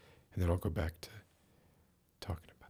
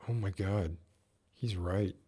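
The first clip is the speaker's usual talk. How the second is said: ordinary delivery, nothing marked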